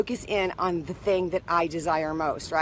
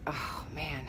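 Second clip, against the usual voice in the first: dejected whisper; 8 semitones lower, 16 dB quieter than usual. Retold or imitated. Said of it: "I'm like".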